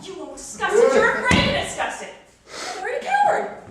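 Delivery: high, loud taunting shout; shrieked challenge; angry accusation. Acted. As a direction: hurl the accusation at full voice. Wife accusing husband in quarrel.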